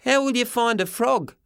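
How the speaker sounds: put-on high doubtful kid voice; boy voice. A narrator's reading. Boy narrator asks Grandpop.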